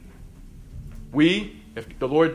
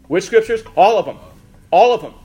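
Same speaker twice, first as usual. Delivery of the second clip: emphatic; 5 semitones higher, 10 dB louder than usual. Real, not acted. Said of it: "emphatic rhetorical answer".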